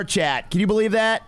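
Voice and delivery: ordinary delivery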